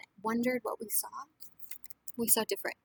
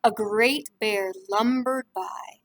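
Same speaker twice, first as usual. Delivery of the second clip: lively storybook reading to a class; reading to children; 10 dB louder, slower than usual. A narrator's reading. Teacher reading a picture book to the class, lively. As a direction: read it warmly to young listeners.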